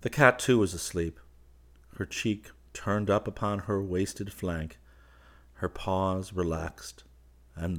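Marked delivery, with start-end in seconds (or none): none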